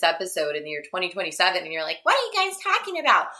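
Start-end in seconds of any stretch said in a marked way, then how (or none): 2.0-3.3 high, put-on voice of a baffled future listener; silly confused listener voice. Host voicing a confused future listener, high and silly. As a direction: squeak it out like a lost newcomer. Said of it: "I'm sorry that your voice sounds like that".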